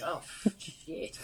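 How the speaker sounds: a whispered "oh, shit", awestruck; a whispered curse as the surf legend walks in. Retold or imitated. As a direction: breathe it out in disbelief. Starstruck seeing Kelly Slater.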